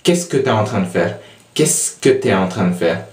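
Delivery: "Qu'est-ce que t'es en train de faire?" run together quickly; quick, relaxed spoken French; fast casual French. Shown as a demonstration. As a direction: rattle it off like everyday speech.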